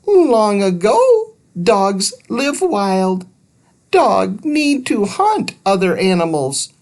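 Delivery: very high squeaky creature voice; little alien. Acted. Thud correcting Andrew about Harley, squeaky and high. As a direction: chirp it like a tiny alien.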